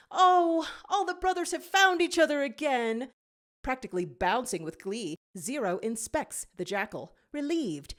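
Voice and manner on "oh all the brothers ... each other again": overjoyed. Acted. Joyful reunion of brothers.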